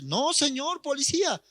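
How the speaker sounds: high-pitched protest to an officer; lightly voiced drunk insisting; drunk young man. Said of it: "el joven borracho también dice".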